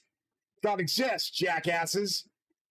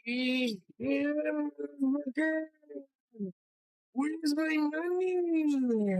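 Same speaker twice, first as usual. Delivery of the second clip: much higher, very slow drawn-out demand; imitating brother; 10 semitones higher, much slower than usual. Mimicking his brother demanding money, high and very slow. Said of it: "The way he would say it was".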